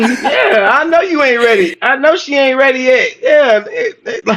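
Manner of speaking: high-pitched, laughing agreement; cracking up, pitch way up; amused heckling. Real, not acted. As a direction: tease through laughter in a high voice. Cracking up at her not being ready.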